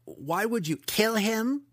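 high, halting incredulous question; falter, then pitch up sharply in disbelief; stammering disbelief. A narrator's reading. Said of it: "he stammered".

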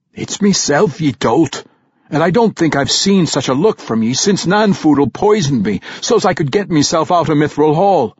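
loud, high dwarf voice with a brogue; dwarf king scolding his friend; gruff dwarf king. Acted. Bruner's dwarf voice raised.

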